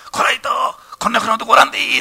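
high, loud scolding bark at Ito; boss shouting. Retold or imitated. Company president shouting at young Ito at the banquet, loud and high.

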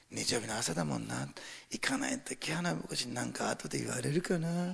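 soft, half-hearted grumble; reluctant churchgoer. Acted. Churchgoer dreading the pastor's comment, reluctant grumble. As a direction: mumble it low-energy, dragging yourself along.

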